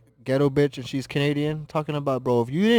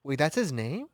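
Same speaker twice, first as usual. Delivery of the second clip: normal talking voice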